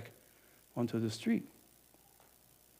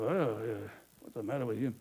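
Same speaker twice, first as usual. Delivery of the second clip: offhand; 5 semitones lower, 9 dB quieter than usual. Acted. Innkeeper answers the door.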